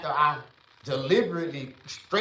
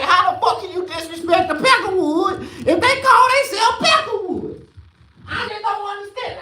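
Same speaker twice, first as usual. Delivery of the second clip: much higher, louder outraged disbelief; shrill mock outrage; incredulous rant; 8 dB louder than usual. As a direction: throw the question up in disbelief. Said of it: "I'm like".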